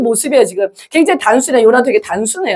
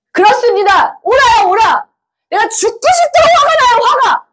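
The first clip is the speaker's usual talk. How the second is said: high-pitched, indignant outburst; angry shouting; 12 semitones higher than usual. Acted. Jonah yelling back at God about his anger, shouting. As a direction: cry out in exasperation, pitch soaring. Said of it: "이렇게 막 소리치는 거예요".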